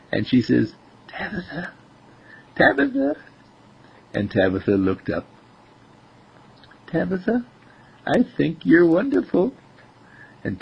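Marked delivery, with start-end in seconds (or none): 1.1-1.7 very soft, high little call; hushed, high-pitched coaxing; secretive ear whisper. A narrator's reading. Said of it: "mouth right next to Tabitha's ear". 2.6-3.2 very high-pitched singsong call; pitched way up to get the cat's attention; high coaxing call. Acted. Calling the cat a second time. 6.9-9.5 high-pitched sweet talk to a cat; small child's high voice, acted; little girl voice. Little girl talking to her cat.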